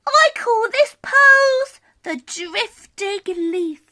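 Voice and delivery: high, slow fox voice, proud of his yoga; a gentle, pleased little fox showing off a pose; high and slow, a fox explaining his yoga; calm Scaredy Fox. Acted.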